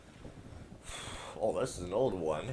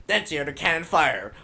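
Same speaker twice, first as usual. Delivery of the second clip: louder, higher dramatic reread; epic reread; 7 semitones higher, 12 dB louder than usual. Shown as a demonstration. Gamer rereads the cannon line, loud and dramatic. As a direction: redo it with big drama. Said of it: "Man, I suck at reading".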